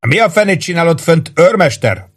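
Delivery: high-pitched angry demand; narrated officer's shout. A narrator's reading. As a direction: yell up at a subordinate. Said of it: "ordította".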